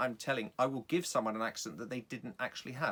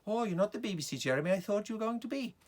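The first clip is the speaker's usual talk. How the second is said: high, lilting Welsh lady; Welsh accent; 11 semitones higher, 4 dB louder, faster than usual. Acted. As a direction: put on a sing-song Welsh accent, pitched up. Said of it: "So I made her Welsh".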